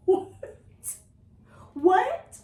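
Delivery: high, loud, incredulous "what"; laughing disbelief. Real, not acted. Reader reacts to strange dialogue, loud baffled laughing. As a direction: crack up, then blurt it in disbelief.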